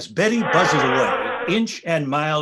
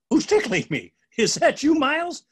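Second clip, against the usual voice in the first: startled question; 11 semitones higher than usual. Acted.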